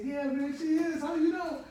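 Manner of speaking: loud, high-pitched eager agreement; retold amazement. Jailmate agreeing his fiance is beautiful, amazed high exclaiming. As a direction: agree loudly, astonished at the guess.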